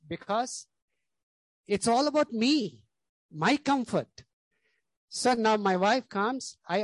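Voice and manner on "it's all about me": ordinary talk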